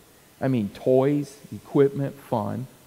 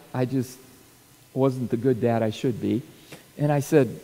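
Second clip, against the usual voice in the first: ordinary delivery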